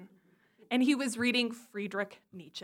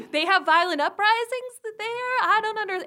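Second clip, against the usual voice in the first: high, loud bewilderment; baffled child; 10 semitones higher, 6 dB louder, faster than usual. Retold or imitated. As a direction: act out a kid who can't follow the adults. Confused child in back seat, acted.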